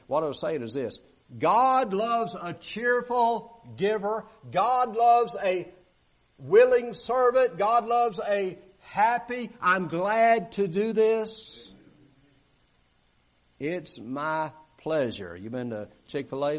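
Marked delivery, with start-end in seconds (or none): 1.4-9.5 slow, loud, high preaching cry; preaching at full force. Acted. 9.6-15.3 glad servant. Pretend.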